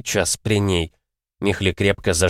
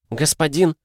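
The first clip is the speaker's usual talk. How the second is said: calling out; 8 semitones higher than usual. Acted. Mikhli bursts in calling out.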